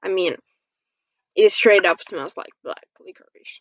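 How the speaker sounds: ordinary talk, nothing marked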